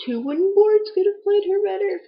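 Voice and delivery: high, loud mocking punchline; mock her acting with a scornful high burst; sarcastic jab. Real, not acted. Mocking an actress's wooden acting.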